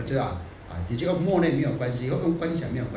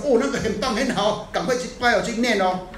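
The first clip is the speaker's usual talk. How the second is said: higher, mocking gush of a gullible follower; mock excitement; 6 semitones higher than usual. Mimicking followers excited by rumors, higher mock gush. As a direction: parrot the eager rumor-chaser, sing-song.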